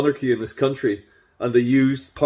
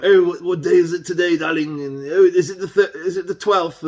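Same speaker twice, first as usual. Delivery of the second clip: mock King Charles; 5 semitones higher than usual. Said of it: "he's like".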